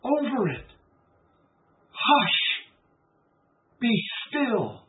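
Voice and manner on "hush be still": a commanding hush, then a firm be still; with authority. Acted. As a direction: speak it like you own the storm. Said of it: "like he has authority".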